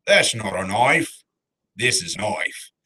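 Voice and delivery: slow, mock Australian accent for the knife line; hammy Australian tough-guy delivery; Australian movie quote. Host shows the only Australian line he can do, in accent. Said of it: "I can only do the knife".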